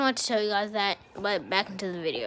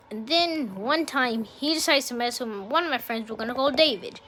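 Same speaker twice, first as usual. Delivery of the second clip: usual speaking voice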